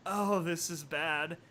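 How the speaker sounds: soft worried murmur. Acted. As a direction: murmur it to yourself. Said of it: "she murmured".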